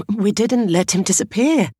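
high, upset woman's voice; defensive. A narrator's reading. Teacher defending herself to the mother, upset. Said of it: "defending herself while her colleague cried".

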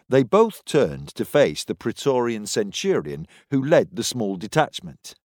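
plain reading voice

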